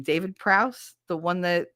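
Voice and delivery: ordinary delivery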